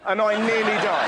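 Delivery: ordinary talk, nothing marked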